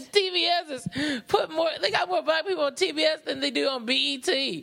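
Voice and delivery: high, fast, excited rant. Real, not acted. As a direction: rattle it off laughing. Excited rant about TBS.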